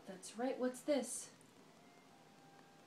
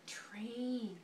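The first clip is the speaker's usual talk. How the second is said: whisper; 3 semitones lower, 6 dB quieter than usual. Real, not acted. Mother whispering the picture's name to her baby. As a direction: breathe it out softly like a secret.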